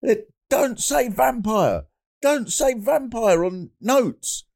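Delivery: high, slow imitated Chinese accent; slow, high-pitched mock Chinese accent. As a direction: mimic a worried Chinese doctor's accent, drawn out. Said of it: "you can imagine in his Chinese accent".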